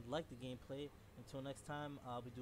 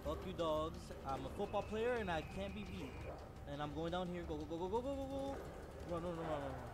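high loud frantic yelling; being chased; 5 semitones higher, 7 dB louder than usual. Real, not acted. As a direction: shout while fleeing dogs.

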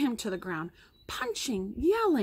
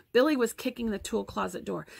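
ordinary delivery

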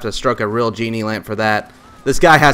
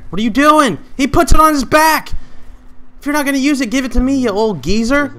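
frustrated shouting at a geezer teammate; exasperated; 14 semitones higher than usual. Real, not acted. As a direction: scold the teammate, pitched way up. Teammate hoards the sniper.

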